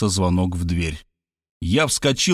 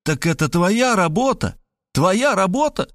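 much higher, heated, pressing; furious accusation; 9 semitones higher than usual. A narrator's reading. Rob attacks his friend with fists, furious and high. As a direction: hurl it at him while lunging. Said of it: "Я с кулаками набросился на него, приговаривая".

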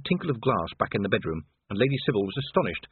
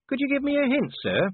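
high, puzzled subordinate's voice; anxious junior officer. A narrator's reading. Cheery asks Vimes for a hint, high anxious voice. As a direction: ask politely, pitched well up.

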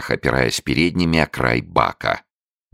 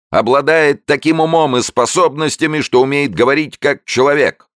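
solemn raised voice; 13 semitones higher, 5 dB louder than usual. A narrator's reading. Showman announcing to the crowd, solemn raised high voice. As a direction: raise the voice grandly. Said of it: "торжественно повышая голос".

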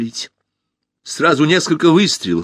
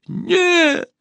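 high drawn-out yelp of refusal; wailing cry. A narrator's reading. Usurper about to be unmasked, high wail. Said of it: "завопил узурпатор".

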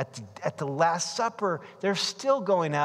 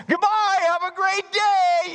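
cheery mom; 14 semitones higher, 6 dB louder, slower than usual. Retold or imitated. Mom dropping teens at school.

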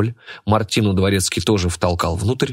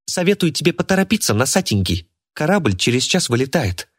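put-on spoiled rich girl; 7 semitones higher than usual. Martina voiced in story.

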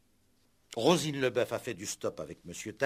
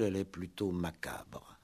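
ominous whisper; 3 semitones lower, 7 dB quieter than usual. Acted. Inspector hinting at murder, whispering ominously. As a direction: drop to a whisper on the dark word. Inspector darkens his story.

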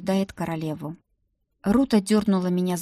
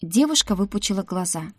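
ordinary delivery

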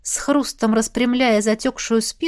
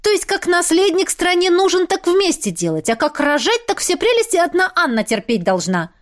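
higher indignant woman's voice; 8 semitones higher than usual. Acted. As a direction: protest it with outrage. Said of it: "возмутилась Элла".

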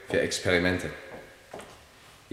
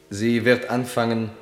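ordinary delivery

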